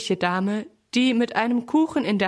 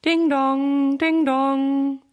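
higher, louder sung ding dong like a doorbell; doorbell chime; 5 semitones higher, 4 dB louder, much slower than usual. A narrator's reading. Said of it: "klingelt es am nächsten Tag".

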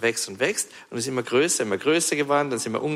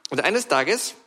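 normal talking voice

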